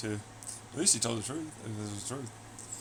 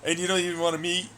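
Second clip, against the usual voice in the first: mock outrage; 7 semitones higher, 7 dB louder than usual. Mocking outrage at leaders.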